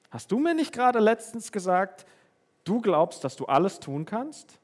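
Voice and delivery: a high, loud, insistent divine reproach; voicing God. Preacher voicing God, pitch jumps high. (Gott, hoch und laut vorwurfsvoll)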